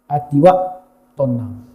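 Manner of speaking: calm and soft. Acted. Preacher describes a calm heart.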